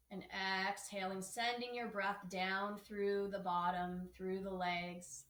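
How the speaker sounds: slow, soft yoga teacher guidance with long pauses; calm, measured breathing-practice voice; guided meditation. Acted. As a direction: lead them gently into the breath, unhurried.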